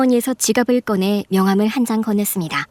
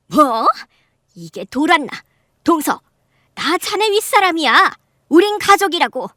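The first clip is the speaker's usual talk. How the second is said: indignant outrage; 7 semitones higher than usual. Acted. Aunt outraged at being yelled at.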